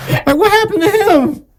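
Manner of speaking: very high-pitched confused partner; mock bewilderment. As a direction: squeak it as a baffled date. Acts baffled partner after accent switch.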